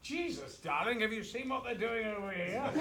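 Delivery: mock shocked actor. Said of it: "he starts off".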